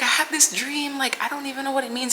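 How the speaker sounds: high, tearful retelling of the dream; telling a friend about the dream, voice high and teary; retold crying. To a friend on the phone, half-crying and high. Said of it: "And I was crying".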